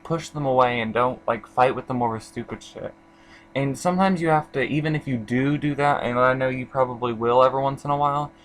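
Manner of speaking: normal talking voice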